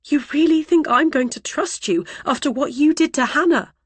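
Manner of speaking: high, sharp accusing retort; angry retort. Acted. Mother accusing the driver over what he did to Hannah. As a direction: throw it back at him. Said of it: "I retaliate".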